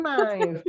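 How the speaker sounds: "knife" with the K sounded, "kuh-naif"; wrong knife. Shown as a demonstration. Teacher exclaiming at the mistake, knife as "kuh-naif". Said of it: "jangan knife".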